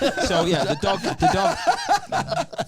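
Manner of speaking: laughing. Real, not acted.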